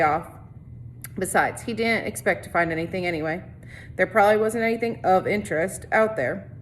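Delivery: steady read-aloud narration for a class; story narration. A narrator's reading. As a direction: read the chapter to the students, evenly. Teacher reads novel to class.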